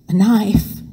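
ordinary talk

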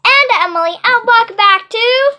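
high, loud welcome; big bright channel greeting; excited intro. Real, not acted.

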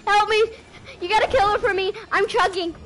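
loud high commands; urgent shouting. Real, not acted. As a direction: bark orders in a panic. Urgent orders in a fight.

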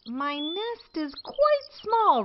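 slow, high Bird voice; a small bird speaking slowly. A narrator's reading.

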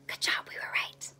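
a hushed whispered cheer. Acted. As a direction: whisper it like a secret. Whispered aside in story.

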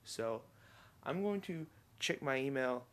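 ordinary delivery

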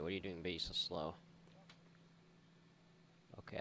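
ordinary talk, nothing marked

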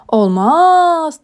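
loud, stretched-out no; firm refusal. Acted. Fly overrules Mina, loud drawn-out refusal. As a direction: forbid it loudly, drawing out the word.

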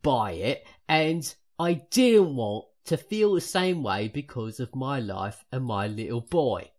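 slow, loud Cockney with big pitch swings; hammed-up Cockney sliding into Michael Caine; Cockney accent. Shown as a demonstration. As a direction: read it as a Michael Caine style Cockney, stretched and swooping. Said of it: "that was more Michael Caine than anything else".

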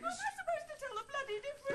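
high indignant outburst; angry yell. Acted. Helper blamed for the wrong pig, yelling back. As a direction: yell back in self-defence.

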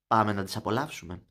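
usual speaking voice

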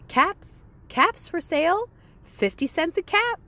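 high, loud street call. A narrator's reading. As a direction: call your wares walking home.

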